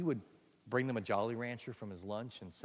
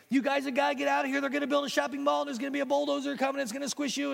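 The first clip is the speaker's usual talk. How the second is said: much higher and louder, rushing the warning; urgent boy warning; 7 dB louder than usual. Acted. Boy warns his ants to flee, high and frantic. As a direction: sound the alarm like a frantic kid.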